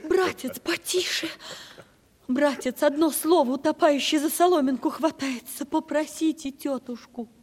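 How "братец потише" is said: higher, urgent plea; alarmed plea. Acted. Niece urging her uncle to keep his voice down, alarmed. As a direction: beg him to hush, startled.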